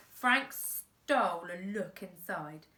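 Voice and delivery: plain reading voice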